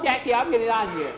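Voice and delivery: high, loud, panicky voice; acted alarm, pitched high; scared boy. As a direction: blurt it out, desperate to get away. Scared boy wants to flee.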